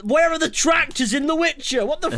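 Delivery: high-pitched comic indignation; exasperated, squeaky-high protest; mock outrage. Comic rant about missing tractors.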